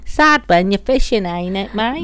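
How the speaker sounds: broad Aussie twang; Australian accent. Pretend. Boyfriend prompted to guess in character, mock Australian accent.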